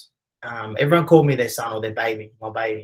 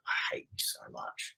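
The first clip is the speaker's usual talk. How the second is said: hushed, drawn-out retold mutter; barely voiced resentful aside; seething under the breath; 12 dB quieter, slower than usual. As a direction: whisper it slowly, meant only for mum. Silent resentment toward mum after interview.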